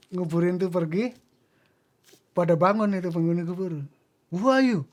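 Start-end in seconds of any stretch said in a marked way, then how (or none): 4.3-4.9 imitating indignant spirits, sharply raised pitch; mock angry challenge. Grave dwellers confronting the newly buried man, mock angry. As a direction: act out an irritated demand, voice jumping up. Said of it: "Pada marah-marah".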